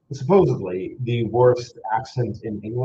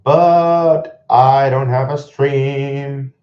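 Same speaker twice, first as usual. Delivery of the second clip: very slow, loud, drawn-out complaint; loud lament; 3 semitones higher, 9 dB louder, much slower than usual. Real, not acted. Streamer with scores but no stream, loud drawn-out lament. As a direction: wail it out, stretching the words.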